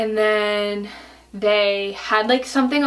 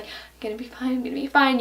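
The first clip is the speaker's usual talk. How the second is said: soft, higher anxious self-talk; nervous self-reassurance; 3 semitones higher, 4 dB quieter than usual. Retold or imitated. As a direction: imitate quietly talking yourself out of fear. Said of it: "I was so scared".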